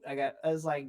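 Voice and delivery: ordinary delivery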